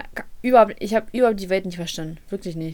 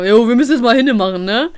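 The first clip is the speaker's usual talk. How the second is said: louder mock hairdresser voice; mimicking the stylist who wants to leave early; 7 dB louder than usual. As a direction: ape her rushing tone.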